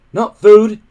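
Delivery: loud high shout; a boy shouting in panic. Acted. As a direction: yell it out in alarm. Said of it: "Hiccup shouted out".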